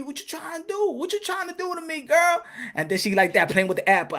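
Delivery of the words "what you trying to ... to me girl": high, soft, weak protest; barely resisting; soft higher pleading. Acted. Adam giving in to Eve, soft and high.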